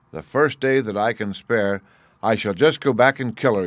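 loud, vengeful giant voice; angry giant. Acted. Giant tells his wife his plan, loud and vengeful. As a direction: vow revenge loudly, as a giant.

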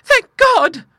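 very high, loud relieved exclamation; fervent cry. A narrator's reading. Clara hearing Harold's debts are covered, fervent. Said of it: "said Clara, fervently".

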